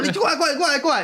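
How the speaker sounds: mimicking an angry mother shouting for her child; angry mom calling. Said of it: "我妈就很生气，说". (模仿妈妈生气地高声叫孩子过来)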